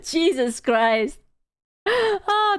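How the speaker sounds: normal talking voice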